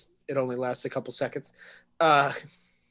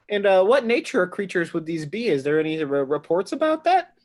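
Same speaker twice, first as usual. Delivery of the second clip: higher, louder eager asking; intrigued questioning; 5 semitones higher, 8 dB louder than usual. Acted. Traven quizzing the lawmaker, eager and intrigued. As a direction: lean in, keen to know more. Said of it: "Traven is very intrigued".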